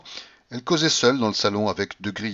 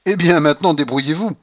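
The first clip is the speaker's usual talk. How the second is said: higher, louder start, brusque; curt dismissal; 6 semitones higher, 5 dB louder than usual. A narrator's reading. Woman leaving the man to his mess, curtly dismissive. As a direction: brush him off impatiently.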